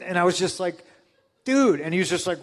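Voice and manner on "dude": high, loud incredulous dude. Retold or imitated. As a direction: call him out in disbelief. Reacting in disbelief.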